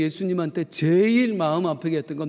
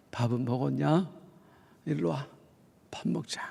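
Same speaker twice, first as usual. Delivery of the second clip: lower, softer, fading to a whisper; tender; 4 semitones lower, 7 dB quieter than usual. Retold or imitated. Jesus gently invites the guilty Peter to breakfast, soft and low. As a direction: invite him gently to eat.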